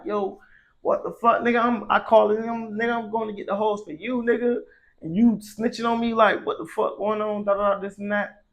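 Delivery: high, heated retold outburst at the friend; retold angry rant. Confronting friend who snitched.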